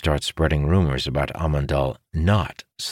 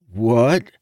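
higher, louder baffled question; confused; 8 semitones higher, 5 dB louder than usual. A narrator's reading. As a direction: blurt it out in puzzlement. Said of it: "confusion spreading across his face".

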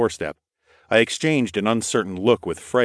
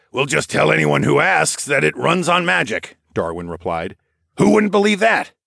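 high, wry quip; joking companion voice; 8 semitones higher, 4 dB louder than usual. A narrator's reading. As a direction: tease them with a grin. Darwin joking.

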